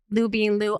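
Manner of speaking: ordinary delivery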